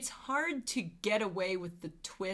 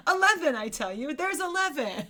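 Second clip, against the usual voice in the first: mock proud count; 7 semitones higher, 5 dB louder than usual. Mock boast about crew size.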